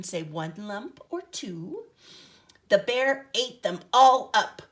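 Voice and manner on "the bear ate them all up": plain reading voice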